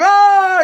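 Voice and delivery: loud, high mock scream; short high-pitched yell of fright. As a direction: imitate a cousin's startled scream. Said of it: "he screamed".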